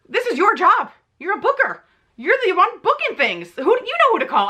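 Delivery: high, insistent telling-off; exasperated scolding. Retold or imitated. Acted scolding of the booker.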